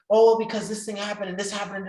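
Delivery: high, loud, rushed excuses; whiny excuse. Retold or imitated. Young man making excuses to his mentor, high and loud. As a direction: blame everything at once. Retelling his own complaints.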